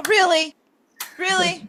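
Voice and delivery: high, loud incredulous "really?"; can't believe they got it that fast; amazed disbelief. Real, not acted. Stunned by the instant correct guess.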